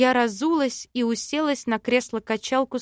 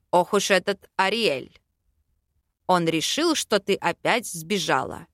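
lower-pitched, grumbling male character; grumbling king; 4 semitones lower than usual. A narrator's reading. King complains to heroine about her absence, grumbling. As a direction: grumble low, mildly put out. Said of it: "ворчал король".

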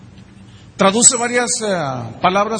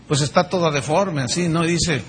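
ordinary delivery

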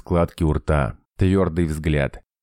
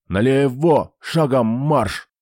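growled orders; 8 semitones higher, slower than usual. A narrator's reading. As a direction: roar at the soldiers. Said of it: "зарычал на подчинённых".

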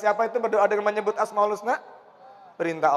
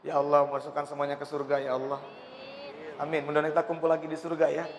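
quiet prayer; 6 semitones lower, 7 dB quieter, faster than usual. Real, not acted. Preacher praying for the congregation.

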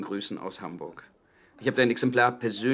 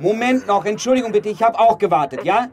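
loud, higher, fending off a man waiting; shouting at the queue; 9 semitones higher, 11 dB louder than usual. Acted. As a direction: call out over your shoulder to hold the phone. Phone booth queue quarrel. (ruft dem Wartenden laut zu, er solle warten)

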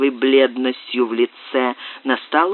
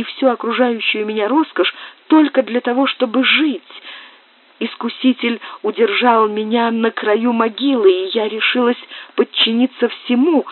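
much higher, pleading desperately; despairing plea; 8 semitones higher than usual. Acted. Susanna begging Brian not to condemn her, high despairing plea. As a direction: beg him not to judge her. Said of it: "вскричала с отчаянием Сюзанна".

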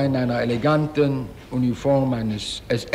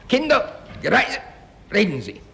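imitated barked command; 10 semitones higher than usual. Witness imitating [name] demanding an answer. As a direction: snap it out like an officer. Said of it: "Er sagte".

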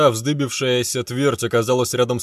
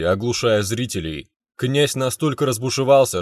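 usual speaking voice

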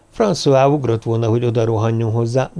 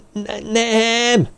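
very high, stammered cry; yelling; 11 semitones higher than usual. A narrator's reading. Jean-Luc refuses to believe François, terrified yelling. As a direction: cry out in panic, drawn high. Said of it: "ordította Jean-Luc".

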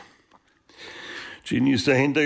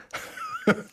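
a sharp high scoffing laugh; scornful laugh; 5 dB quieter than usual. Acted. Vito mocking the idea of blackmail photos, scornful laugh.

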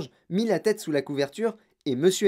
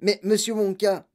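plain reading voice

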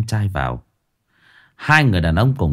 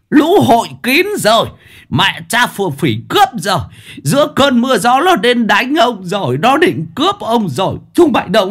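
much higher, louder panicked howl; howling in pain; 11 semitones higher, 3 dB louder than usual. A narrator's reading. Chief hit in the face howling for his guards, loud panicked yell. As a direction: cry out for help in pain and panic. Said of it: "một tay rú lên".

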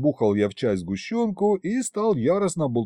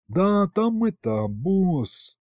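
higher, drawn-out, embarrassed hedging; embarrassed stalling; 6 semitones higher, much slower than usual. A narrator's reading. Flustered demon reporting to his boss, embarrassed. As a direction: stall sheepishly, stretching the words. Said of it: "Бес вдруг смутился".

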